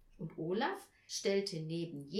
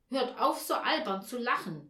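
sharp, louder, raised-pitch telling-off; vehement scolding; 3 semitones higher, 7 dB louder than usual. A narrator's reading. Mother to her giggling children, vehement scolding. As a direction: scold them with sudden force. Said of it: "sagte sie heftig".

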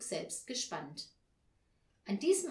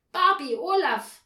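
high, very loud summons; calling from indoors; 8 semitones higher, 14 dB louder than usual. A narrator's reading. Mother calls her children from inside, loud call. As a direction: holler the children's names. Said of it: "rief da Frau Janka von drinnen".

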